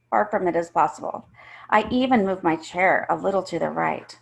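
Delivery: usual speaking voice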